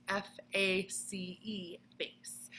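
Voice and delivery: slow whispered F A C E, face; whispered spelling. Acted. Teacher whispering the spelling of face to students. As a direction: breathe the letters out slowly. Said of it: "spelling a memory word to class".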